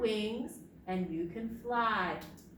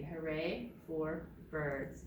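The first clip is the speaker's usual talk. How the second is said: ordinary delivery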